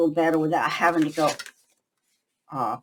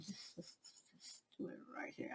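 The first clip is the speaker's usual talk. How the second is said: whisper; 29 dB quieter than usual. Real, not acted. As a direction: whisper the instruction gently, no voice at all. Quiet instruction to a small child.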